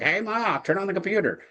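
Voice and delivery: hammed-up yell across the house; mock yell. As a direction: call out for Ma in a high, strained mock shout. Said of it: "yelling at my wife".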